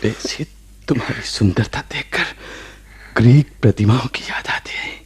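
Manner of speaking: hushed loving whisper; romantic whisper. Acted. Husband whispering a compliment to his wife.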